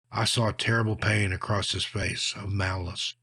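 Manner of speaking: usual speaking voice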